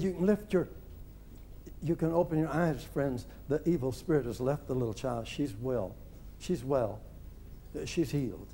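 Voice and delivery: soft, low, gentle announcement of healing; understated, reassuring, almost matter-of-fact; calm, not worked up. Retold or imitated. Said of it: "he didn't get worked up about it".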